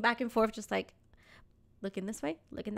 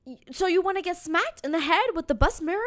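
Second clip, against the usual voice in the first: higher, louder retold threat; scolding, half-playful yell; mock yelling; 7 semitones higher, 5 dB louder than usual. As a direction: imitate a driver telling off passengers. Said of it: "He starts yelling at them".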